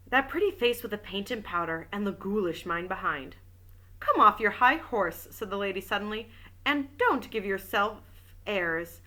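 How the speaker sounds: even audiobook reading voice; story narration. A narrator's reading. Reading a detective novel aloud, steady narration. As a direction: read the novel aloud to listeners.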